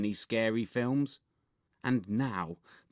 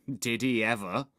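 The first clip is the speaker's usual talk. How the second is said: usual speaking voice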